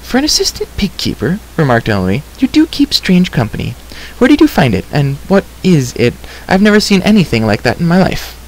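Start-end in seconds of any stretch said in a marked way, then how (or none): 0.0-1.4 put-on high-pitched girl's remark; teasing girl. A narrator's reading. Girl remarking on Terran's company, teasing. 2.4-8.3 put-on high-pitched, chattering girl's voice; curious girl. A narrator's reading. Girl quizzing Terran about his strange friend, curious.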